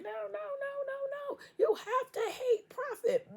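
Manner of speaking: high, slow mock crying whine; mock whiny wail. Mocking a hater wailing at the reader. Mocking someone whining about her.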